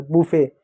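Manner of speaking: बुफे said "boo-fay", no t; correct pronunciation. Shown as a demonstration. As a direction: say बुफे with a silent final t. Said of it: "बुफे होता है". (बुफे, बिना 'ट' के सही उच्चारण)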